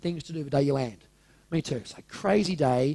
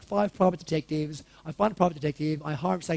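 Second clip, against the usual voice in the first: normal talking voice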